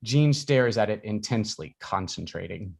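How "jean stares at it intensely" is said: ordinary delivery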